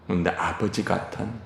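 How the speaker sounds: ordinary talk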